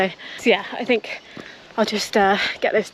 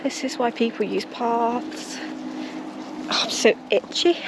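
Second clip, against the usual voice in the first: moaning; 3 semitones higher, slower than usual. Real, not acted. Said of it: "I'll stop moaning now".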